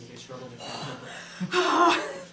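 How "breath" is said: ordinary talk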